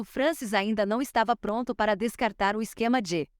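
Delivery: usual speaking voice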